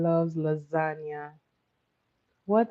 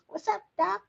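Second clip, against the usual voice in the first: much higher put-on cartoon rabbit voice; Bugs Bunny catchphrase; 14 semitones higher, faster than usual. Quiz host quotes Bugs Bunny.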